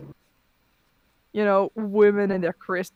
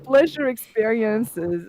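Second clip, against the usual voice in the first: slow mock reading; 4 dB louder, much slower than usual. Reading the post aloud slowly.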